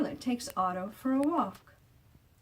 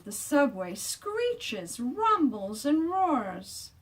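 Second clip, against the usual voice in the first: loud; 6 semitones higher, 5 dB louder, much slower than usual. A narrator's reading. Subway noises acted.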